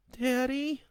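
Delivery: high, small frightened voice; a child calling for her father; scared little girl. A narrator's reading.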